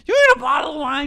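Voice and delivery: high, fast whiny offer; drunk Miranda. Retold or imitated. Miranda offering a bottle, high whiny voice. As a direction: whine it like a tipsy friend.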